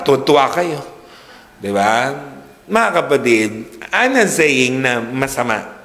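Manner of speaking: normal talking voice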